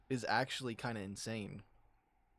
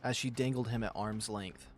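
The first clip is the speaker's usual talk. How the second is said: plain reading voice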